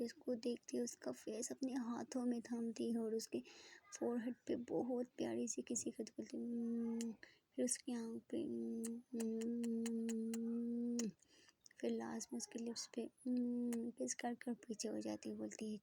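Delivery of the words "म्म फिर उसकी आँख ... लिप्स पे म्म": soft kissing hums; soft little kiss sounds. Acted.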